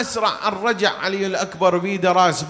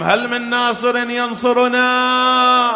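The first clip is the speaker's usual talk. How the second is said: calling out; 5 semitones higher, slower than usual. Acted. Husain calls for helpers.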